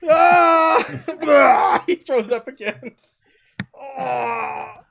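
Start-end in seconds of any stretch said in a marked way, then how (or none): 0.1-1.8 loud frightened yelp. Acted. Witness yelps.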